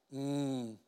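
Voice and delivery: very low, hushed savoring murmur; calm, not mad. Acted. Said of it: "He's not mad".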